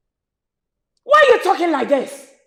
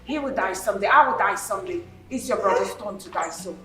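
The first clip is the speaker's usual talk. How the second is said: low, soft, unmoved delivery; cold, heartless; 9 semitones lower, 11 dB quieter than usual. Acted. Widow dismissing her husband's brother's grief, coldly. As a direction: state the death coolly in a lowered voice. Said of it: "are you this heartless?".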